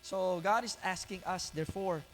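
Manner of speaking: usual speaking voice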